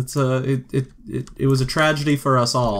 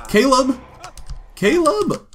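high, loud call across the room; shouting a name to get attention; 5 dB louder than usual. Real, not acted. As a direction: call his name to get him back. Calling out to her Sim.

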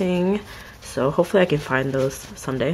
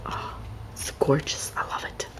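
awed whisper; 8 dB quieter than usual. Real, not acted. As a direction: hush it like a treasure. Awed by rare card.

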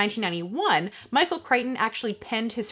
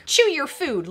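put-on raised, bossy little girl telling off a pet; a young girl scolding a dinosaur; child's scolding voice; 6 semitones higher, 4 dB louder than usual. A narrator's reading. Reading aloud, voicing Lex scolding the baby dinosaur.

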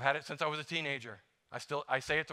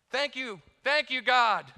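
higher, louder gushing thanks; effusive thanks; 9 semitones higher, 6 dB louder, slower than usual. Pretend. Preacher voices a healed person thanking God, gushing. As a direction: gush with relief. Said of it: "thankful when you get healed".

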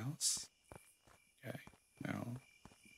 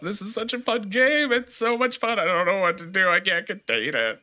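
high, bright sarcastic gushing; mock excitement; 9 semitones higher, 3 dB louder than usual. Streamer opening a horror game, mock gushing. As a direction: gush over the game with fake delight.

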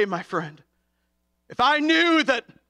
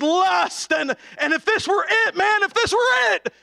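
preaching at full force; 10 semitones higher than usual. Acted. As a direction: urge them on, rising to a cry.

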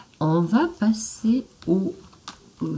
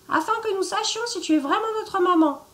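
put-on much higher childlike voice; little goat kids; 12 semitones higher, faster than usual. The little goats demand proof, in a high childlike voice. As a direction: ask it like wary tiny goats. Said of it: "crièrent les chevrons".

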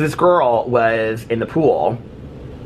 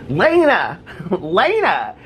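retold shouting; 6 semitones higher, much slower than usual. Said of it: "she kept on shouting at her friend".

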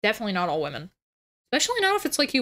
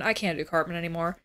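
ordinary talk, nothing marked

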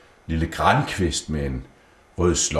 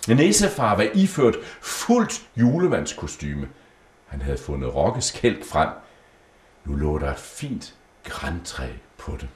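ordinary delivery

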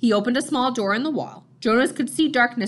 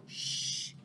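shush; 14 dB quieter than usual. Acted. Giver hushes Jonas, eyes on screen.

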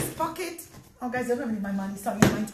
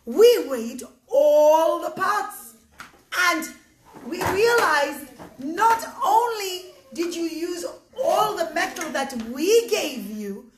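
impressed judge; 3 semitones higher, 4 dB louder than usual. Acted. Judge tells Moshe what the pots weighed, impressed. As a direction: stretch the key words in amazement.

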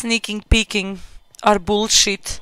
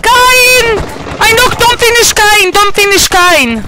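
urgent callout; 8 semitones higher than usual. Real, not acted. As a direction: cry out in alarm over the game. Urgent callout after being knocked down.

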